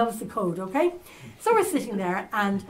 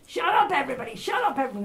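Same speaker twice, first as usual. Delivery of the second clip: raised, commanding call over a crowd; shouting; 5 semitones higher, faster than usual. Retold or imitated. Sky News reporter on a bank shouting at the media crowd. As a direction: bark it out to silence the crowd. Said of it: "he started shouting".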